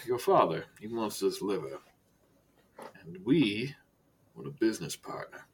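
low, soft, measured director voice with long pauses; Director Silas, understated menace. Acted. Director turns to business with Archibald, quiet and measured. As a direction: speak quietly and let each phrase hang.

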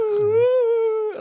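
high eerie ghost moan; ghostly wail. Pretend. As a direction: float it up like a ghost. Ghost voice demo.

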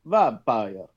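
hint of a Transylvanian accent. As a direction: say it like a Transylvanian count. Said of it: "Say vampire in a Transylvanian accent".

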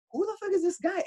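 high-pitched incredulous question; mock bafflement. Retells his bafflement at being stared at.

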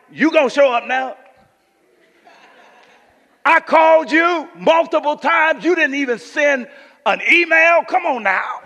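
loud, high-pitched challenge, acted out; preacher voicing a fed-up complaint at full volume; indignant Mary. Mary confronting Jesus for coming late, indignant. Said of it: "'Cause Mary was like".

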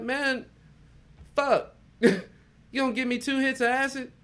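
high and loud, a fast middle; mock exasperated yell. Retelling to his friend his outraged reply about the acid. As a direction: yelp it out, indignant and shrill. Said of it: "I was like".

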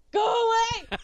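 retold shout. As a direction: yell at the steward to go away.

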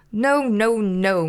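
mildly moaned protest; a touch of despairing whine; moaning. A narrator's reading. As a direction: moan it in frustration. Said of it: "moaned Big Bird".

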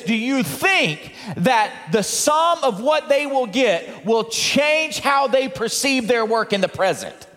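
normal talking voice

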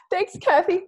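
high, grateful thanks; a choked-up thank you; tearful thanks. Retold or imitated. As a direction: thank her through tears.

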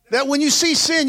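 ordinary delivery

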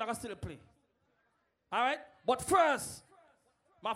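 ordinary talk

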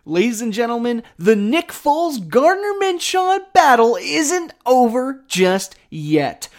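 big hyped announcer voice, high and bright; announcer intro. Acted. Host opening his podcast, booming announcer style. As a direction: open the show like a sportscaster.

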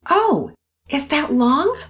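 innocent question. Acted. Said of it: "Pearson asked quite innocently".

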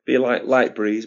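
ordinary delivery

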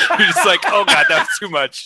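high, loud laughing through the words; can barely get it out for laughing. Real, not acted. Laughing at the Love Guru to his friends, high and loud. Laughing while quoting himself.

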